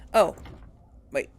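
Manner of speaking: normal talking voice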